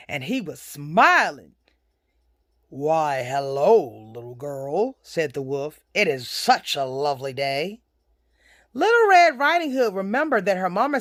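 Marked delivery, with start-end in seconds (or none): 2.7-5.0 sly wolf. Acted. Wolf greets girl in story. 5.9-7.8 low wolf making polite small talk. Acted. Wolf greets girl in story.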